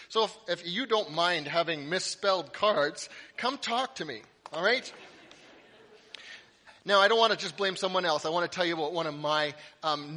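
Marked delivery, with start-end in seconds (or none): none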